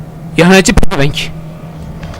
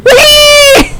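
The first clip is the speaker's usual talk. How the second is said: sudden high cry of fright; jumpy high shriek; startled yelp; 3 dB louder than usual. Real, not acted. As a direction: yelp as if jumped at.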